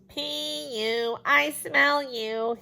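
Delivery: put-on a boy teasing the girl about her smell. A narrator's reading. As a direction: drag it out to mock her. Teasing boy holding his nose.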